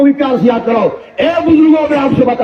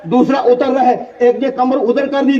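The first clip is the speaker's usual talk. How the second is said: ordinary delivery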